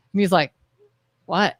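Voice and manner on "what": low, quiet one-word retort; husband's curt tone. Retold or imitated. Wife imitating husband questioned on his tone, curt. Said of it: "Everything is said with a tone".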